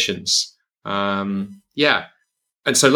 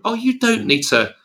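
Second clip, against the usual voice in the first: mock dismissive advice; 13 semitones higher than usual. Voicing what strugglers are told.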